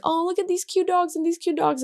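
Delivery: high-pitched retold cooing; cooing over dogs. Couple spotting dogs in Italy, cooing high. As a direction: coo in a high voice, as if spotting puppies.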